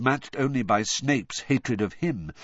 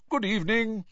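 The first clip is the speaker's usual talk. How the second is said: beaming; 12 semitones higher than usual. Acted. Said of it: "beaming at them".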